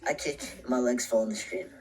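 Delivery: ordinary delivery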